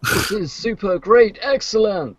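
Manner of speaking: high, slow, over-the-top game announcer; enthusiastic announcer. Retold or imitated. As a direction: cheer the racer on like a hammy announcer. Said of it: "Gotta sound enthusiastic, like the, uh, annoying announcer".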